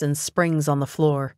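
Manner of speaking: ordinary delivery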